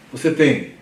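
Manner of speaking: ordinary talk, nothing marked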